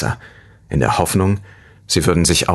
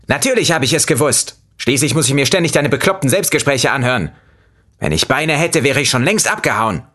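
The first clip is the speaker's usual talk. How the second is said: higher, snide fruit voice; snide lemon voice; 9 semitones higher than usual. A narrator's reading. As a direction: sneer it as the fed-up lemon. Lemon character speaking.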